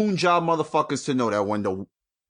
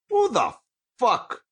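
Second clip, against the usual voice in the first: squealed outrage; 11 semitones higher, slower than usual. Real, not acted. Outraged rhetorical question.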